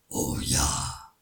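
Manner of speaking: very low, eager troll; troll eagerly accepts the eating contest, very low. A narrator's reading. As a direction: agree like a greedy troll. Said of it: "antwortete der Troll begeistert".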